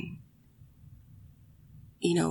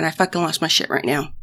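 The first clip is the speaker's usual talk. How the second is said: ordinary delivery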